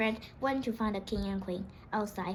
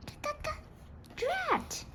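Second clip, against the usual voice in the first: high frustrated exclamation; exasperated high-pitched outburst; frustrated cry; 6 dB quieter than usual. Acted. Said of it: "Peg cried".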